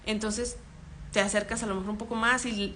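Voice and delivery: usual speaking voice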